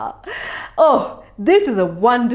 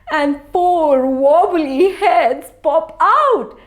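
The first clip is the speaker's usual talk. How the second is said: ordinary delivery, nothing marked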